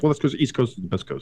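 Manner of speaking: usual speaking voice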